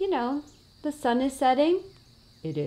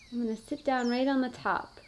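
ordinary talk, nothing marked

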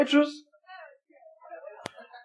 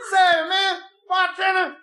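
put-on much higher, whiny drunk plea; whining drunk; 11 semitones higher than usual. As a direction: whine like a sloppy drunk. Drunk orders at bar.

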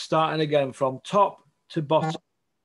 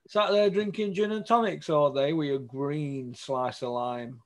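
ordinary talk, nothing marked

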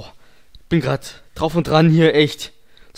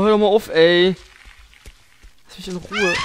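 usual speaking voice

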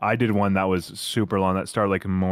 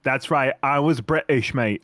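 playful put-on British reveal; hammed-up British, proudly announced; British accent; 2 semitones higher than usual. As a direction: own up in a mock British accent. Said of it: "And then you're like".

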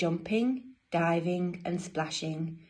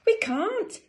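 very high, louder storybook voice; penguin siblings' reply; 5 dB louder than usual. Acted. Brothers and sisters answering Dudley, high-voiced protest. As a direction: pipe up as little penguins.

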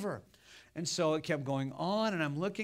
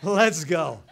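exasperated; 8 dB louder, slower than usual. Retold or imitated. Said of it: "I said".

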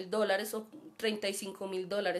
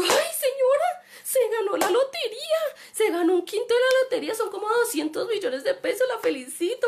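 gushing excitement; 11 semitones higher, 6 dB louder than usual. Retold or imitated. Retelling how she congratulated the scammer, thrilled and squealing. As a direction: sing out the good news like you're overjoyed. Congratulating lottery winner, retold.

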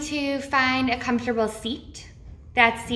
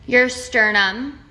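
slow, measured meditation voice with long pauses; name each body part slowly, leaving space after it; guided body-scan meditation. Real, not acted. Guide leading listeners through a body awareness meditation, slow and measured.

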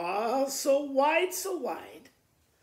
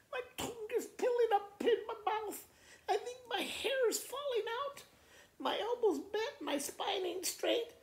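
put-on high, whiny little-girl complaint; whiny sick child; 10 semitones higher than usual.

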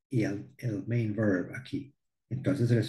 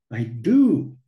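"do" punched louder as the husband insists; husband insisting on "do" to his wife; emphatic do; 6 dB louder than usual. Retold or imitated. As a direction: lean hard on "do". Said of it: "hacerle énfasis".

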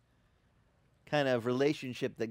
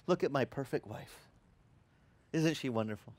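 softer, admiring husband; right way, tender; 2 semitones lower, 5 dB quieter than usual. Shown as a demonstration. Pastor showing husbands the right way, soft and tender. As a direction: say "Look at my perfect wife" gently, proud of her. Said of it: "You have to say".

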